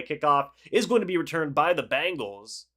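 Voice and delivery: normal talking voice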